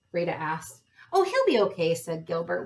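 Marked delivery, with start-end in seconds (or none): 1.1-1.9 higher, louder "don't worry" lilt; breezy reassurance. A narrator's reading. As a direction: wave the worry away, pitched up. Brother brushes off the worry.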